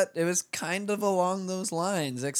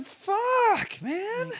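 much higher, slow, stretched disbelief; stunned reaction. Retold or imitated. Reacting to the acid-spiked Yeti with a high stunned squeak. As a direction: let it squeak out in shock. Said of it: "I was like".

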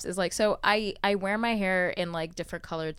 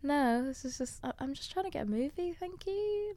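put-on high, prim British accent, softer; 7 semitones higher, 7 dB quieter than usual. Woman insisting to her friend in a British accent. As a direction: brush her off in a lightly posh British voice. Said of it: "my British accent".

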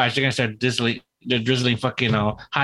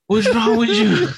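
slow, much higher and louder outraged question; mock disgusted Mexican; 10 semitones higher, 5 dB louder, slower than usual. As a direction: throw it out like a shocked onlooker. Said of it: "and they're like".

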